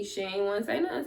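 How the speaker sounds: normal talking voice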